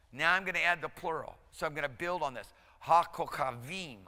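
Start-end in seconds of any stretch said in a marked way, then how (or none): 2.8-4.1 HaKochavim said slowly, syllable by syllable; broken down slowly. Shown as a demonstration. Teacher to students, HaKochavim slowly broken into syllables. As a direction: spell HaKochavim out in slow beats. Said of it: "I'm gonna break it down".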